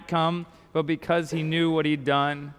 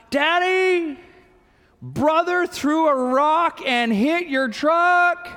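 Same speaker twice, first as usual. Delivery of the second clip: put-on higher, slow, breathless child's voice reporting trouble; a small son blurting bad news in a high voice; excited little boy; 8 semitones higher, much slower than usual. Son runs in reporting truck.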